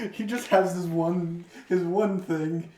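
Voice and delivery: usual speaking voice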